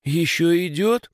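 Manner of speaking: put-on high, anxious girl's question; frightened. A narrator's reading. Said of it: "переспросила она испуганно". (испуганным голоском)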